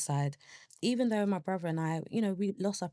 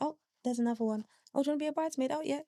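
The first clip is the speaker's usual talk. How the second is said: much higher, quick mock-agreeable voice; put-on agreeableness; 9 semitones higher, much faster than usual. Griever agreeing to everyone's wedding requests, put-on agreeable high voice. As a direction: go along with it in a high, light voice. Acting out obliging reactions to milestones.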